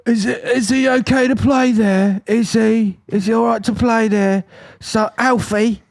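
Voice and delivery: high mock Essex mum, slowed; imitating a posh-ish Essex mother. Essex mum asking if her son can play: high mock Essex voice. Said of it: "she was like".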